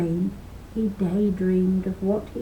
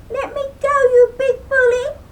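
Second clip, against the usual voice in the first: high, loud tiny-creature wail; squeezed leprechaun crying out; 7 dB louder than usual. Acted. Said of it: "cried the leprechaun".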